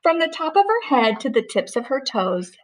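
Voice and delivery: put-on measured read-aloud voice for a child; a parent reading a rhyming story aloud; storybook narration. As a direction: read it like a bedtime picture book. Mother reads picture book aloud.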